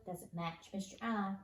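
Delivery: ordinary delivery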